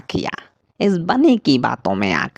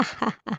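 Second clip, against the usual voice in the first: greedy chuckle; 7 semitones higher, 12 dB quieter than usual. Acted. Crow gloats over carrots.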